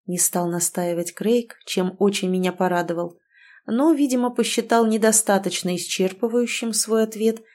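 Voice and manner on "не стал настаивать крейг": usual speaking voice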